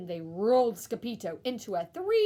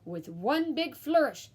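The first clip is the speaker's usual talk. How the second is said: usual speaking voice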